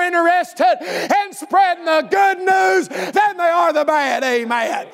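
driving revival sermon cadence; full-force preaching. Real, not acted. Preacher rallying church to spread good news, full-force preaching. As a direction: preach it hard, stretching the big phrases.

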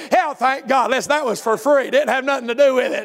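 normal talking voice

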